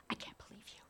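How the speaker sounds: whispered, breathy complaint; mother whispering her complaint at her daughter; whispered grumble. Retold or imitated. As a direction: grumble it under your breath.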